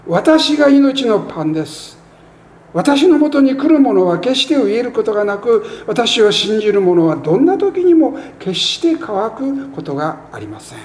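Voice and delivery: scripture reading. Acted. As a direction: lift the verse up to the congregation. Scripture read aloud in sermon.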